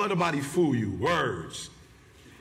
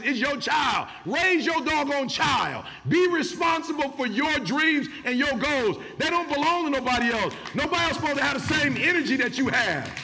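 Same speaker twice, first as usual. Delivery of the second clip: preaching at full force; 8 semitones higher than usual. Real, not acted. Preaching peak.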